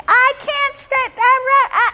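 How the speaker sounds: hammed-up shrieking mother losing it; imitating mom going ballistic. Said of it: "She went ballistic".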